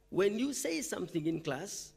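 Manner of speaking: ordinary talk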